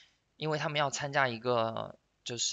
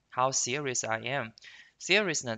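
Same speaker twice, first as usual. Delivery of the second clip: normal talking voice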